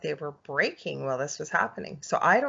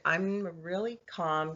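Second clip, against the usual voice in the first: usual speaking voice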